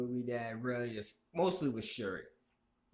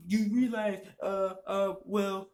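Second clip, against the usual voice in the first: higher, whiny mock voice of a know-it-all fan; higher, whiny mimicry of an online opponent; mocking the male feminist; 7 semitones higher than usual. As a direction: parody the smug fan higher and whinier. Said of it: "This dumb motherfucker wants to talk".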